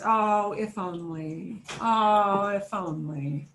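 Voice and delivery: slow, drawn-out wishful sigh of words; acted yearning, trailing down at the end; wistful wishing. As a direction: stretch it out as a longing wish. Acting out the meaning of ojalá.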